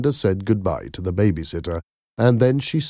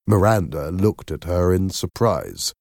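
ordinary delivery

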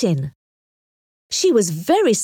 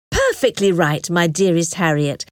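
ordinary delivery, nothing marked

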